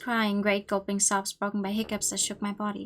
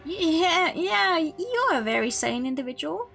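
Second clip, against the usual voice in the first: sarcastic; 7 semitones higher, 4 dB louder, slower than usual. Real, not acted. Mocking the villain's self-praise.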